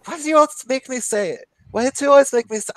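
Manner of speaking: imitating a thick Norwegian accent, high; a mock Norwegian roommate's lilt. Roommate asked to say the word, in a Norwegian accent. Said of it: "thick Norwegian accent".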